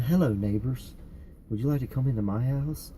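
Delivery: lightly voiced evil children's-show host; a hint of sinister neighborly welcome; softly put-on villainous host greeting; Mister Dodgers. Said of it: "he's, like, the evil version".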